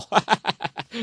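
big pleased ha-ha; hearty laugh. Acted. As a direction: throw back the head and guffaw. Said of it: "周伯通哈哈大笑".

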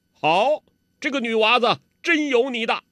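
a loud, high cheer from the side; calling out praise. Acted. Hong Qigong cheering the girl's move from the side, loud. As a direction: cheer the girl on from the sidelines. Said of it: "洪七公在旁边叫道".

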